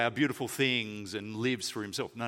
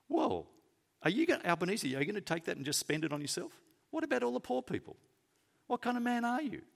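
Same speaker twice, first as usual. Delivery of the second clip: mock media critic; 9 semitones higher, faster than usual. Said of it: "the media goes".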